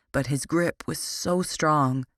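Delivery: plain reading voice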